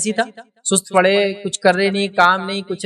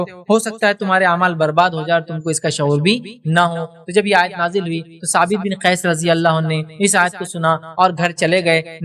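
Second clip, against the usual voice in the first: ordinary delivery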